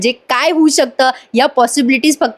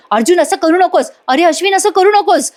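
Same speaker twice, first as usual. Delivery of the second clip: higher, pleading call from behind; retold shouting; 7 semitones higher than usual. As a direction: call out to stop them, a mock shout. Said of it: "Priya ओरडत असते मागून".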